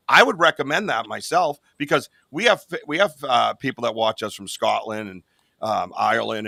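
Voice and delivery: ordinary delivery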